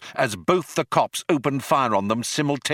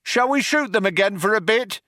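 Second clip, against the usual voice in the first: high raised call; cop shouting; 12 semitones higher than usual. A narrator's reading. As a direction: shout it to the partner. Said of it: "One cop shouted to the other".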